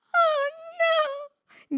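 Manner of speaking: high crying wail of alarm; a little girl sees her doll about to fall; shrill-free high panicked cry; frightened cry. A narrator's reading. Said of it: "grita Emi".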